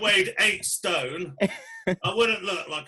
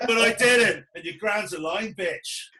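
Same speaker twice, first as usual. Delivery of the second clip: put-on old grandad storyteller; 4 semitones higher than usual. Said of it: "Tell my grandkids about it".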